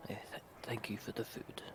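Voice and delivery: hushed, breathy whisper, speeding up at the end; whispered thanks. Acted. Half-orc whispering his thanks to the old soldier. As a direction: keep it a private whisper between friends.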